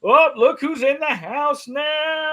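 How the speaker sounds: high, slow excited shout; excited greeting. Real, not acted. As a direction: welcome a friend with a shout. Greeting a guest loudly.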